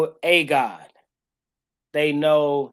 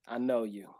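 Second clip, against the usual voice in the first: much softer, intimate I know you; hushed God voice; 6 semitones lower, 11 dB quieter than usual. Retold or imitated. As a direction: say it like God leaning close to whisper. Voicing God again, hushed.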